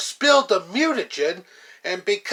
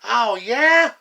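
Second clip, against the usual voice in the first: defiant retort; 3 semitones higher, 6 dB louder than usual. Acted. Villains squabbling, trading taunts.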